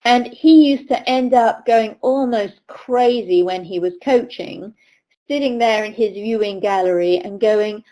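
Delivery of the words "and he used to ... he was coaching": normal talking voice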